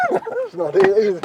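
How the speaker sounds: high laughing exasperation. Real, not acted. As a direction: laugh at the failure. Seat fails, laughs.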